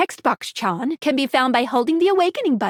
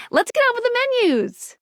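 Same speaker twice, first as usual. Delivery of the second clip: high, cheerful sing-out; perky rallying call; 10 semitones higher, faster than usual. Acted. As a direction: launch it with bouncy enthusiasm. Cheerfully launches the menus.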